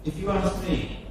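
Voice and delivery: ordinary talk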